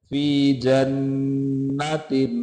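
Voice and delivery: जन्नतिन with a held nasal nn; full nasal ghunna. Shown as a demonstration. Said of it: "गुन्ना पूरा करना है".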